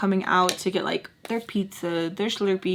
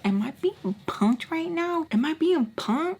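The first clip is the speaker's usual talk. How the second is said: high-pitched disbelief; high incredulous aside; 7 semitones higher than usual. Retold or imitated. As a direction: ask it high, as if you can't believe it. Can't believe what he says.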